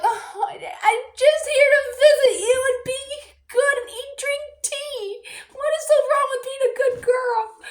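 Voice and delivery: high, whiny hammed-up innocence; pretending to be an innocent prisoner for the boy, high and whiny; mock innocent prisoner. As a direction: play the wronged good girl, high and whining. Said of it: "I was just playing along".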